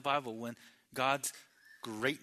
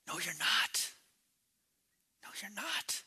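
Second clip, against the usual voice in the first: soft, knowing correction; hushed rebuttal; 4 semitones higher, 5 dB quieter than usual. Acted. Preacher answering those who'd question the Lord, hushed rebuttal. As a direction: drop to a near-whisper to contradict.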